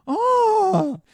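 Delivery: very high, loud drawn-out "oh"; exaggerated squeal of dawning recognition; mock realisation. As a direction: imitate her penny-dropping moment, way up high. Acts out her sudden realisation.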